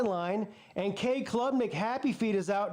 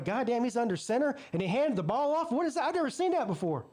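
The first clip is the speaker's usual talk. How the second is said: mock disbelief; 4 semitones higher than usual. Coach on the sideline baffled by the play, mock incredulous. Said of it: "Garrett Riley's on the sideline going".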